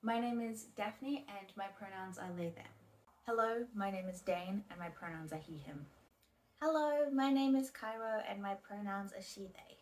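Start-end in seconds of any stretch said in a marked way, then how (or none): none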